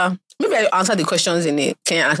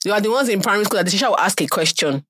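ordinary talk, nothing marked

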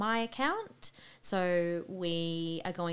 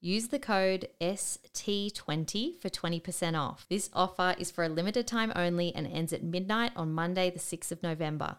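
hard sell ad; 6 dB louder than usual. Acted. Sponsored ad read.

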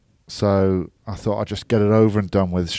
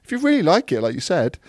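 mock squeaky teasing; 12 semitones higher, 4 dB louder, faster than usual. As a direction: needle him in a high squeaky voice. Retold teasing, very high voice.

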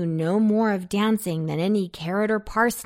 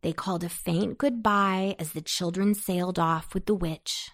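plain reading voice

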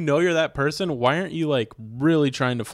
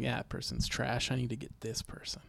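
muttered inner thought; 3 semitones lower, 14 dB quieter than usual. Retold or imitated. Acting out her inner thought.

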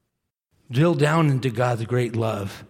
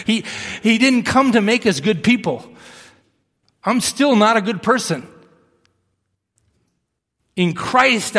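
higher and louder, pushing the congregation; fervent preaching; 5 semitones higher, 6 dB louder than usual. Acted. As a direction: pound home "alive" like a revival preacher. Preaching at full force.

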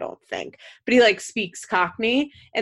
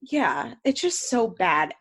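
ordinary talk, nothing marked

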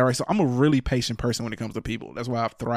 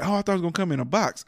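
mimicking wife; 6 semitones higher, faster than usual. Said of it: "she was all like".

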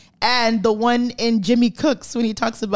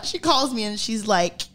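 ordinary talk, nothing marked

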